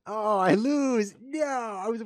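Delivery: high, loud wail of defeat; shocked yelp at losing; dismayed cry. Real, not acted. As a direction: cry out at the loss. Losing a chess game live.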